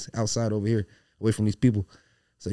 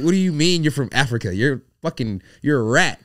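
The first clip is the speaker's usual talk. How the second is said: angry; 4 semitones higher, 9 dB louder than usual. Retold or imitated. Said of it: "And I was like".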